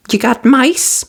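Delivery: high brisk fairy voice; quick and businesslike; fiery fairy. A narrator's reading. Fiery fairy asking Cinderella for mice, brisk. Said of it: "asked the fiery fairy".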